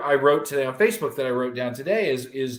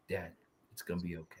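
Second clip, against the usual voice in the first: soft reassurance; 8 semitones lower, 17 dB quieter, much faster than usual. Retold or imitated. Son reassuring him in his ear.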